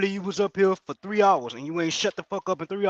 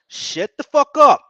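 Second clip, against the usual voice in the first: loud, slow, higher-pitched yell; furious shout; 5 semitones higher, 8 dB louder, slower than usual. Real, not acted. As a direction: bark it out slowly, louder each word. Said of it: "why I'm talking like this".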